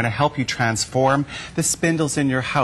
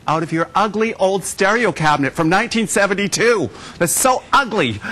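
mock disgust; 7 semitones higher than usual. Mocking ugly old furniture.

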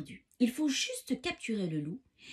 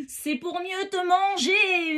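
loud, high, stretched-out yell; abominable wolf howl; 8 semitones higher, 6 dB louder, slower than usual. A narrator's reading. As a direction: howl it slowly as a horrible wolf. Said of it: "hurle le loup de sa voix abominable".

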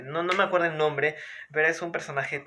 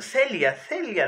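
excited naming; 8 semitones higher, 4 dB louder, slower than usual. Real, not acted. Excitedly naming the dream girl.

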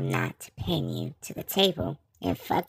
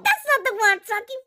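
shouting; 8 dB louder, faster than usual. Acted. Heated outburst continues.

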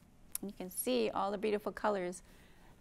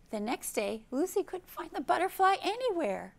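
lively storybook reading; reading to children; 3 semitones higher, 4 dB louder than usual. Acted. Teacher reading a picture book aloud, lively. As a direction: read it to a circle of little kids.

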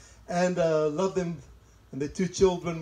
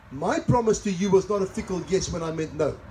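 usual speaking voice

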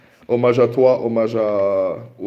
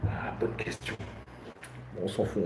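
whisper; 11 dB quieter than usual. Real, not acted. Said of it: "Faut pas que je crie trop".

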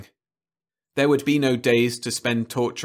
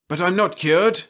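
desperate protest; 7 semitones higher, 5 dB louder than usual. Acted.

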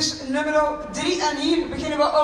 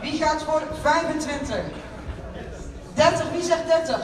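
auctioneer; 4 dB quieter than usual. Acted. Runs the bidding.

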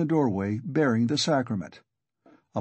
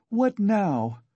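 high-pitched, moderately raised cry; exasperated cry; 9 semitones higher than usual. A narrator's reading. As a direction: cry out sharply in a much higher pitch. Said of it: "cried Katerina Ivanovna".

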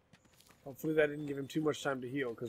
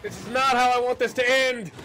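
desperate shout; 9 semitones higher, 11 dB louder than usual. Real, not acted. Desperate in fight.

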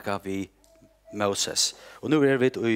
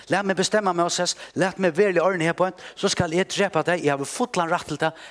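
voicing God, louder and higher with stern force; angry God; 6 semitones higher, 4 dB louder than usual. Preacher voicing God threatening Moses, loud and stern. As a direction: thunder it as God's judgement.

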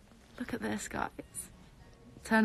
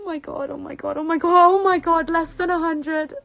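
high repeats swelling louder; panicky excitement; 6 semitones higher, 6 dB louder than usual. Real, not acted. Streamer seeing under a hundred left, panicky excitement. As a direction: build from a high murmur into a loud cry.

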